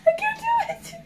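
very high whiny squeal; scared. Real, not acted. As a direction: wail it high, close to crying. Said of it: "I'm so scared".

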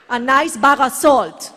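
ordinary talk, nothing marked

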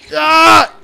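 short, high, loud scream; sudden yelp in the heat of a game; startled scream. Real, not acted. Cries out during game combat.

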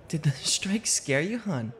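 a wife's hushed, playful jab; teasing wife. Acted. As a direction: tease your husband softly for jumping. Wife teasing at the ball game.

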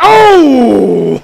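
long, loud falling "oh"; loud yelp of dismay. Real, not acted. Player hit while starting a story, loud cry. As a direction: cry out as if struck mid-sentence.

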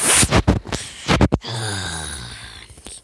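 long breathy annoyed sigh. Real, not acted. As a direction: let out a long fed-up sigh. Annoyed at the result.

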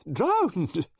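high, loud exclaimed "Drowned!"; near hysterical cry. Acted. Mrs Oliver telling Poirot how the girl died, crying out "Drowned!". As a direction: blurt "Drowned!" in horror. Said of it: "I was getting hysterical".

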